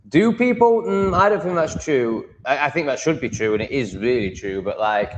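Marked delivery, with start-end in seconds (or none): 0.1-2.3 incredulous. Real, not acted. Incredulous reaction to quote.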